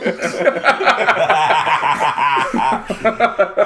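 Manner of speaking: long, high burst of laughter; drawn-out hearty cackling; real belly laugh. Real, not acted. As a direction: laugh hard at your own punchline. Laughing at own punchline.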